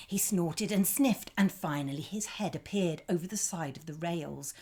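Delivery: plain reading voice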